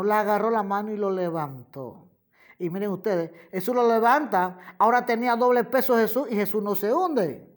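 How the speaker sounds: ordinary talk, nothing marked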